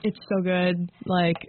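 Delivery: talking while chewing; mouth full. Real, not acted. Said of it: "I'm eating".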